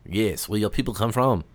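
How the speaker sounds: put-on French accent; hammy French questioning. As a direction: keep up the mock Frenchman. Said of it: "best French voice on for this".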